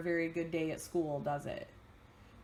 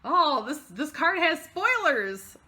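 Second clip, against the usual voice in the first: excited; 8 semitones higher, 7 dB louder, slower than usual. Real, not acted. Excited at card.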